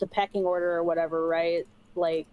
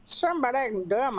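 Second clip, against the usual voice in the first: silly mock echo of a friend; lightly put-on mocking voice; teasing mimicry; 3 semitones higher than usual. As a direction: parrot it back teasingly. Mockingly echoing friend's words.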